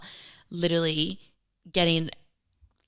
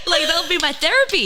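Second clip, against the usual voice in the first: laughing; 11 semitones higher, 5 dB louder than usual. Real, not acted. Laughing at own joke.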